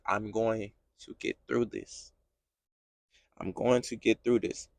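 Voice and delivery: very low, barely voiced affirmation; reply softly, holding on to faith; hushed resolve. Believer's words back to God.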